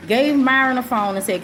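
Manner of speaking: ordinary talk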